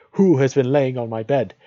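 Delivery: high, loud dwarf outcry; dwarf crying out. A narrator's reading. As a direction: cry it out as an alarmed dwarf. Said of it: "and cried".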